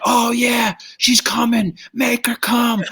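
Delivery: high-pitched leering DJ hype; vulgar DJ. Retold or imitated. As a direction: imitate a young DJ egging on the crowd. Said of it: "D J's like".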